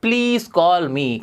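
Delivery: stretched very slowly, each word apart; slow model phrase. Shown as a demonstration. Teacher modelling a new phrase for students, very slow. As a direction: draw the phrase out for the class. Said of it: "दूसरा तरीका है".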